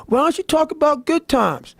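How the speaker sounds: high, lightly mocking voice of critics; mimicking Facebook critics, up high; mock whiny question. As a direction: pitch it up like a nagging commenter. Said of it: "everybody goes".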